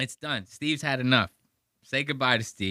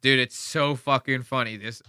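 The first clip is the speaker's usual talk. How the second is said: usual speaking voice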